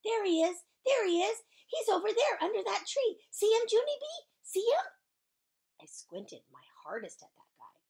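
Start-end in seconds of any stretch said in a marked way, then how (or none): 0.0-4.9 high, loud excited pointing out; squealing, high-pitched excitement. Acted. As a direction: burst out like you've spotted a star. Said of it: "very excited".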